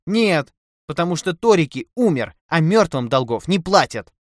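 high, loud scolding; angry tirade. A narrator's reading. Wife berating her husband over the lost money, angry and loud. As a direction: rail at him, hammering each point.

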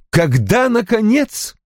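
a wife asking her father-in-law coldly. Acted. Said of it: "холодно спросила Ким". (холодно, сдержанно)

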